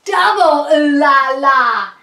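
big, fancy swoon of excitement; awestruck exclamation. Acted. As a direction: belt it out, thoroughly impressed. Even more impressed by the gala.

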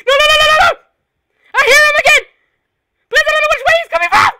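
high loud fast panicked shouting; panicked laugh-screaming. Real, not acted. Panic as killer returns.

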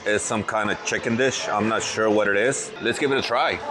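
ordinary talk

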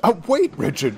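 high, loud cry of alarm; cried out. A narrator's reading. Thomas cries out to Richard to stop, high and loud. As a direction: call out to stop him. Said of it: "cried Thomas".

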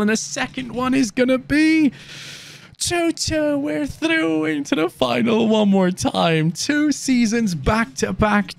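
high, rising, near-shouted prediction; excited hype. Real, not acted. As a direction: call the score like it's already won. Hyped predicting the final score.

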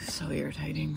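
very soft, whispered grumble; exasperated whisper. Real, not acted. Fed up with co-host's teasing, exasperated whisper. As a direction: whisper it as a complaint. Muttered complaint about co-host's mocking.

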